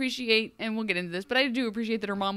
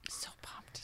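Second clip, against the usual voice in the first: hushed excited whisper; 20 dB quieter than usual. Real, not acted. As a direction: whisper it like a secret. Whispered then repeated aloud.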